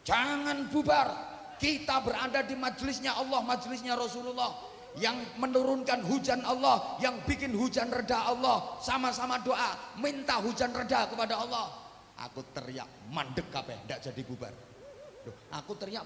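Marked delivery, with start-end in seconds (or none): 0.0-11.7 loud, higher call to stop the congregation leaving; shouting to the crowd. Retold or imitated. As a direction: shout over the rain to keep them seated. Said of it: "saya teriak".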